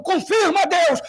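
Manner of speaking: high loud shouted praise; congregation shout. Retold or imitated. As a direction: cry out like a fired-up crowd. Crowd shout imitated.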